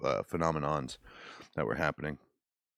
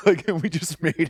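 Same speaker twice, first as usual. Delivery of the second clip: laughing through the words; high giggly laughing words; 12 semitones higher, 7 dB louder than usual. Real, not acted. Laughs while joking about curse.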